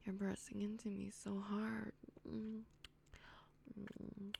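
ordinary talk, nothing marked

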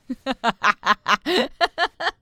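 loud mocking laugh; cruel laugh. Captor laughing as the captive struggles.

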